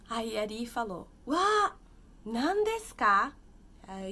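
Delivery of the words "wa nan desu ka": a mock-surprised "Wa", much higher and louder. Teacher voicing Ri opening the gift, mock surprised. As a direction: act out delighted surprise at a gift.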